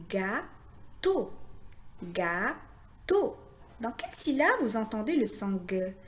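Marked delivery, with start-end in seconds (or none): 0.1-1.3 "Gâteau" as two separate beats, gâ, then teau; split into syllables. Shown as a demonstration. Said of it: "composé de deux syllabes". 2.0-3.3 "gâteau" said as gâ, pause, teau; split into syllables. Shown as a demonstration. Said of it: "composé de deux syllabes".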